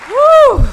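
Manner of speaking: high, loud cheering whoop; a real joyful stage whoop; excited whoop. Real, not acted. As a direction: whoop to the crowd like a party. Cheering herself onto the stage.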